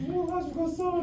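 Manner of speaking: put-on high, soft 붕어가 죽었어 like a sad small boy; crying child. Mother retelling her son's words, high and soft. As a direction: voice the sobbing son lightly. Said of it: "이렇게 얘기하는 거예요".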